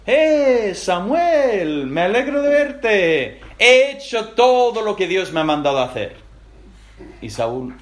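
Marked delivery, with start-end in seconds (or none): 0.1-6.2 high, loud, too cheerful welcome; a guilty king hamming up his delight; overeager greeting. Acted. Saúl greets Samuel with fake high cheer.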